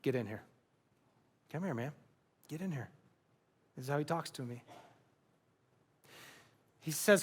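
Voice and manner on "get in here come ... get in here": low, soft, offhand "get in here, man"; a hint of a relaxed pal's voice; casual buddy Jesus. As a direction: call him in like an old friend. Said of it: "The John Peacock translation".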